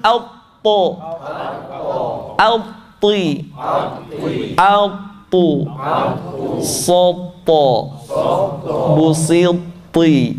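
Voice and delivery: pause, then release each heavy T in SOPO, BUSITI; thick to. Shown as a demonstration. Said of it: "terdengar tebal suaranya". (huruf T tebal, pelan terpisah)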